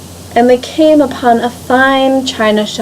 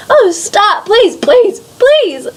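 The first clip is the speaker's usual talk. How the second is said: high, rising begging; desperate pleading; 9 semitones higher, slower than usual. Acted. Teacup begging the master potter to stop, high and pleading.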